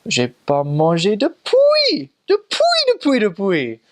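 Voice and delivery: imitated Cameroonian French, loud and slow with soaring pitch; Cameroonian French inflection. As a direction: mimic the Cameroonian lilt, swooping high on "depuis". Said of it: "do that little, uh, like, inflection".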